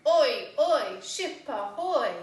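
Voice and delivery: loud, high pirate shout; pirate call. Pretend. Phonics teacher playing a pirate to show the oi sound, loud pirate call. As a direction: bellow it like a pirate hailing a ship. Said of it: "like a pirate says".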